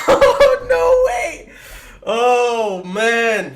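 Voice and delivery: high, loud laughing disbelief; shocked laughter. Real, not acted. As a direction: shriek and laugh at the impossible win. Unbelievable lucky outcome.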